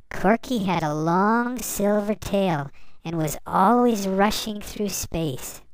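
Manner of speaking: ordinary delivery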